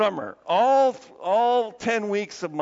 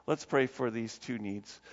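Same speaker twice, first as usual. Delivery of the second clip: ordinary talk, nothing marked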